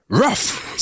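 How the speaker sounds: short high bark-like word; talking dog. Acted. Dog answers its owner in front of the bartender, barked word. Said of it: "says the dog".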